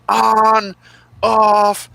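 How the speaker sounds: high, loud drawn-out yell. Retold or imitated. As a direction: call out long and high like a kid. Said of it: "my brother and I would just yell".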